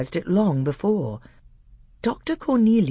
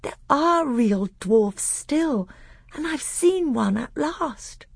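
put-on high, slow, marvelling boy's voice; overjoyed young prince, pitched way up; sheer delight; 13 semitones higher, 3 dB louder, slower than usual. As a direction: draw it out in thrilled wonder. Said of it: "The other was sheer delight".

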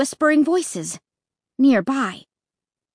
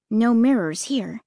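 usual speaking voice

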